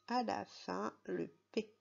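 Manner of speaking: normal talking voice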